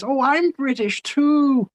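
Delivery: very high, slow put-on voice. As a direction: playfully claim to be British. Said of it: "he says".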